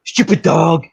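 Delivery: sweet-sounding insult to the dog; mocking dog talk. Friend showing his dog understands only tone, teasing. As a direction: cheerfully insult the dog in a happy tone. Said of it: "I went".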